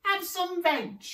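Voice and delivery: very high, loud duckbill hoot; high hooted welcome. A narrator's reading. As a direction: hoot the invitation, slow and high. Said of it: "And they hooted".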